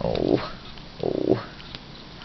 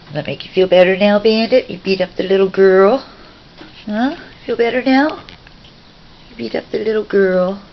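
higher, louder, faster playful scolding; teasing scold; 6 semitones higher, 5 dB louder than usual. Real, not acted. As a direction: tease the bird for bullying.

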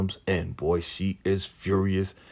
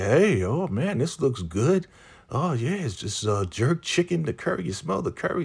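high, gushing food delight; excited Laura; 9 semitones higher than usual. Retold or imitated. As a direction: raise the pitch and gush over the smell.

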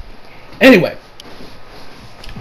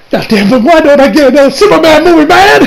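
rising, louder, higher whine that ends in a laugh; mock frustrated outburst; 8 semitones higher, 5 dB louder than usual. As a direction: complain like a wronged fan, half joking. Retelling his frustrated reaction.

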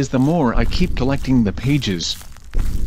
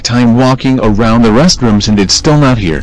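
angry; 4 dB louder than usual. Acted. [name] rages at the game.

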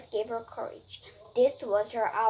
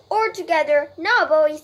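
louder, higher rallying call; rally the helpers to lift; 5 semitones higher, 10 dB louder than usual. A narrator's reading. Farmer rallies men to lift.

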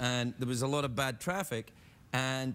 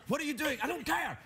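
high-pitched ranting in a retelling; worked-up, high mock tirade; angry Glaswegian; 11 semitones higher than usual. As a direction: act out your furious Glaswegian self. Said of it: "to meet an angry Glaswegian".

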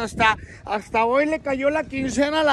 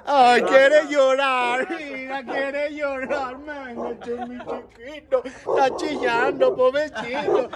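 slow, lower, drawn-out cooing; cooing sympathy; 3 semitones lower, slower than usual. Real, not acted. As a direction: coo over a tearful little one. Cooing over José starting to cry.

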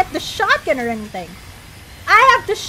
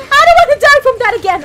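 faster, still loud but without the laugh; quicker exasperated repeat; 6 semitones higher, 8 dB louder, faster than usual. Real, not acted. Streamer repeating his complaint to chat, fast and exasperated. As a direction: rattle it off in exasperation.

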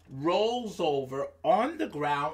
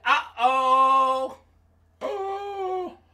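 high, drawn-out uh-oh; alarmed mosquito; 6 semitones higher, 4 dB louder, much slower than usual. Acted. Storyteller as mosquito seeing the sky might fall, alarmed. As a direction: play a worried little storybook mosquito.

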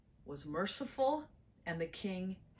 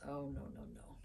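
Jesus correcting Peter, gently; 5 semitones lower, 11 dB quieter, faster than usual. Acted. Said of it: "Jesus answered him and he says".

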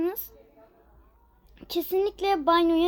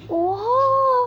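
loud startled oha; surprised exclamation; 8 semitones higher, 5 dB louder than usual. Real, not acted. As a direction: blurt it out in shock. Game jumpscare.

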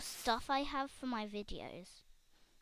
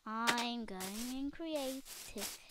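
slow sly aside; much slower than usual. Retold or imitated. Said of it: "I just go".

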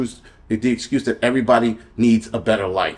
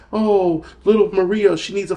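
much higher sarcastic pity voice; high mocking plea on behalf of little Maria; mock sympathy; 7 semitones higher than usual. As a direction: sneer out the fake sympathy, pitched way up. Mocking sob-story about immigrants.

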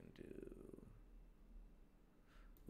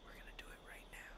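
fast hushed whisper; 15 dB quieter, faster than usual. Pretend. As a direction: whisper it like a sneak. Sneaky whisper.

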